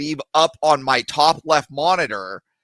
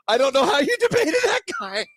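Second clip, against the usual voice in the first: high-pitched, cracking with laughter; laughing disbelief; 13 semitones higher than usual. Retold or imitated. Caller retelling his reply to Andrew about the debate, laughing disbelief. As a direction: squeal it incredulously through a laugh.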